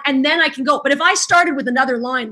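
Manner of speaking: ordinary talk, nothing marked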